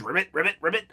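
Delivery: croaking ribbit ribbit like a frog. Pretend. Vlogger doing random frog noises, playful croak. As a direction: make silly frog noises. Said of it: "I make random, uh, animal noises".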